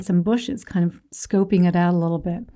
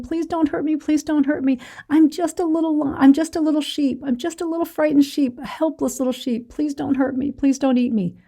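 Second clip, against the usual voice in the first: high, timid begging voice; a small cub pleading with the big lion not to hurt him; pleading scared cub; 6 semitones higher than usual. Acted. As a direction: beg for your life like a frightened little cub. Scared cub begs adult lion.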